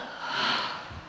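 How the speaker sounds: breathy exhale letting the air go; releasing sigh. Shown as a demonstration. Releases demo breath for the class, long sigh. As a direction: let the whole breath fall out. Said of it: "And let it go".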